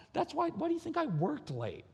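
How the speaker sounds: high-pitched incredulous question; mock exasperation. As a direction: ask it high, as if fed up. Acted exasperation about home chaos.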